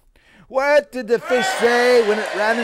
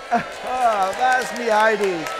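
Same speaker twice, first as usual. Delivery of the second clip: ordinary talk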